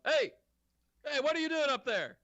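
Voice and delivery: high-pitched guard calling out; yelling up. Acted. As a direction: call up sharply to someone, voice pitched high. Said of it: "you hear a, a guy yell up".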